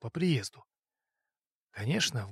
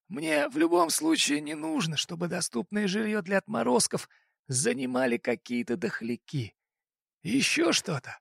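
raised-pitch voice for the fat boss; crime boss voice; 7 semitones higher than usual. Acted. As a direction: play the smug boss making an offer. Boss voice again.

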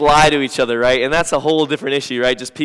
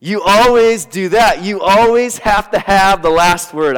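higher, louder, slow nagging accusation; accusing spouse; 6 semitones higher, 6 dB louder, much slower than usual. Retold or imitated. Pastor acting an accusing spouse mid-fight, nagging. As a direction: hurl it like a fed-up spouse. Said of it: "as we say statements like this".

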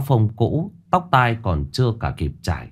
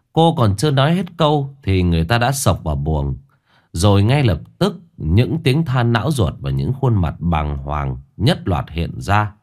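plain reading voice

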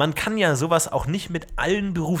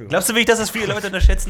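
high, fast, loud mock doubt; teasing challenge; 7 semitones higher, 5 dB louder, faster than usual. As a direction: needle him playfully.

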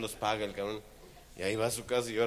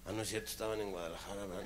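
ordinary talk

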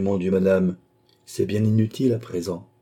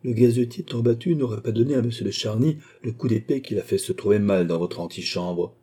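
plain reading voice

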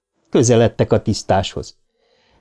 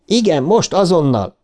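higher, almost yelling; frantic, near a shout; nearly shouting; 7 semitones higher than usual. A narrator's reading. Eddie insisting to the porter, almost shouting. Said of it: "Eddie majdnem kiabált".

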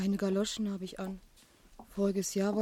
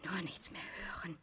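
much softer whispered longing to escape; whispered inner voice; 9 dB quieter than usual. Acted. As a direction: breathe the words as a private wish. Christine's inner wish to flee.

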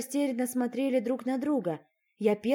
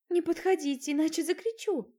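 higher, scared warning; frightened princess; 7 semitones higher than usual. Acted. Cornered princess warns the unseen intruders, frightened. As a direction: keep them away, voice up high in fear.

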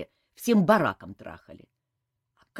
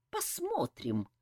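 squinting menace; 7 semitones higher, 5 dB quieter, slower than usual. A narrator's reading. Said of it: "зло прищурилась Саэта".

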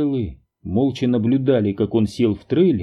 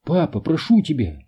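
a daughter's gentle reproach, pitched up; a daughter reproaching her father; reproachful plea; 5 semitones higher than usual. A narrator's reading. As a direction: plead with him, disappointed. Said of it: "с укором проговорила Бетти".

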